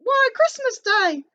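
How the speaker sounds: put-on high, slow street-boy accent; boy's accent. The boy answering Scrooge in a high, slow accent. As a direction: pipe it up like a cheeky young lad. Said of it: "doing an accent for him".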